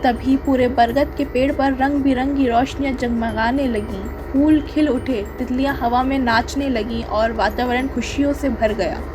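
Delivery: ordinary delivery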